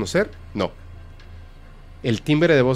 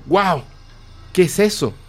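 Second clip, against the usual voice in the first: impressed wow; 5 semitones higher than usual. Retold or imitated. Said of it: "Así impresionado".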